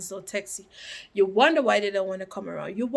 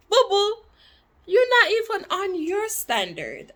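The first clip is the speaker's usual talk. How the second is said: sassy comeback; 10 semitones higher than usual. Real, not acted.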